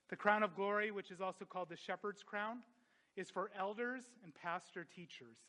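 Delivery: ordinary delivery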